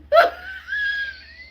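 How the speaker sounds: very high shrieking laugh, almost a scream. Real, not acted. Viewer shrieks with laughter at the joke. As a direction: let the laugh break into a shriek. Reacting to funny clip.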